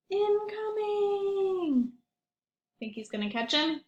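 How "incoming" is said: a gasp, then high and slowly stretched out; gasping surprise. Acted. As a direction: gasp and draw out the warning. Gasps as penguin falls.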